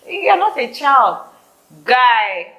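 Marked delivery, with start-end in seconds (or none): 0.0-1.3 high, scolding mock question; nagging mocker. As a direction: mimic a nagging critic. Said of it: "Everybody's always asking me".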